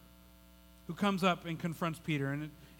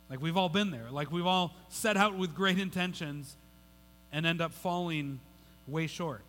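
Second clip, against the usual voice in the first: ordinary delivery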